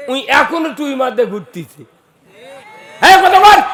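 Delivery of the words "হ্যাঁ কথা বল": loud, high shout to the crowd; shouted demand. Real, not acted. Preacher prodding the audience to respond, shouted demand. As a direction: call out sharply for an answer.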